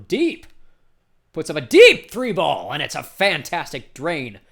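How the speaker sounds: loud burst on the big shot; excited play-by-play call. Real, not acted. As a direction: erupt as the long three drops. Commentator calls a scored long shot.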